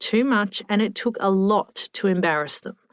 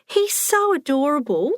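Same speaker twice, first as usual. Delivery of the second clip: put-on high, fluting coo over a child; doting and pitched up; gushing old lady; 7 semitones higher than usual.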